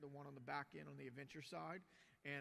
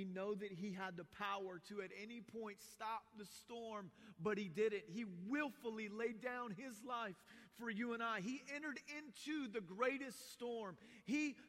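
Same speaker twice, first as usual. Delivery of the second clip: louder, higher preacher's drive; fervent preaching; 5 semitones higher, 6 dB louder than usual. Real, not acted. Pastor urging the church to trust Jesus, rising fervent preaching. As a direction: press the point home to the congregation.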